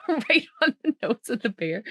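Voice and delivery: ordinary delivery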